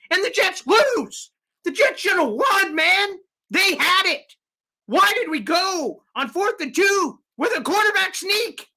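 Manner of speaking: a fan shouting over the loss; frustrated yelling. Real, not acted. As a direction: yell at the screen as they blow it. Fan venting over a blown game.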